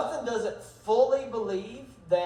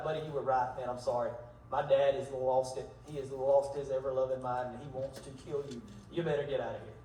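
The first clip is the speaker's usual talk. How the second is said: hushed warning; 6 semitones lower, 6 dB quieter than usual. Retold or imitated. Jonathan quietly warns his best friend David to run, hushed. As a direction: lower and softer, a confiding near-murmur.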